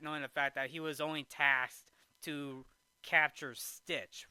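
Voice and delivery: ordinary talk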